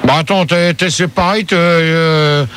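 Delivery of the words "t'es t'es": usual speaking voice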